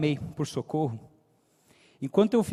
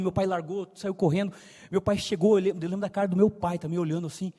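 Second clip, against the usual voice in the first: ordinary delivery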